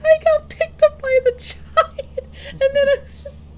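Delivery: high, loud laughing protest; laughing and half crying. Real, not acted. Freaked out after giant grab.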